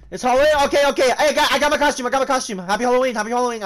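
high, loud, tumbling burst of delight; excited. Real, not acted. Gamer learns it is Halloween, giddy excitement. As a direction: burst out with repeated excited cheers.

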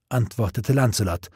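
usual speaking voice

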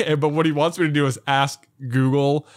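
usual speaking voice

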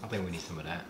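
quiet whispered aside. Real, not acted. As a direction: whisper it under the heat. Whispered about milk.

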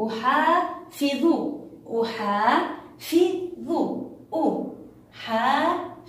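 stretched, separated syllables in أحافظ; split into syllables. Shown as a demonstration. Teacher breaking a word down for the class, syllable by syllable. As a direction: sound out أحافظ slowly, syllable by syllable. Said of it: "ونريد أيضًا أن نقطعها".

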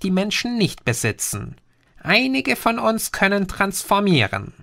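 a patient know-it-all explaining; Green's voice. Acted. Green describes the Skromex. (leicht höher gesprochener Außerirdischer, belehrend)

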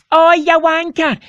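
ordinary delivery

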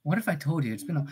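ordinary talk

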